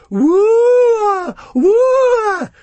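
loud, high whooping cry; jeering whoops. A narrator's reading. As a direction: egg him on with long jeering whoops. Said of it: "sort of egging this guy on".

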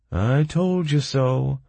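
high, slow, sorry drawl; sad drawl. A narrator's reading. Prisoner grumbling to fellow convicts, sad drawl. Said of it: "sadly drawled another prisoner".

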